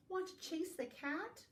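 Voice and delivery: high-pitched animal invitation; high, lilting dog question; storybook dog. Acted. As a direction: ask eagerly as the dog. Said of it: "barked the dog".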